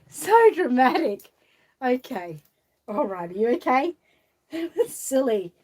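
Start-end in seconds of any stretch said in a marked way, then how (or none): 0.1-1.3 louder and higher, drawn out slowly; hammed-up teasing. Shown as a demonstration. So dramatic drawn out slowly, much louder and higher. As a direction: ham up the so dramatic.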